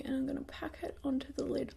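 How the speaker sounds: very soft, concentrating whisper; hushed and absorbed in the task; whispery aside. Real, not acted. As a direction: narrate your make-up step under your breath. Absorbed aside while applying make-up.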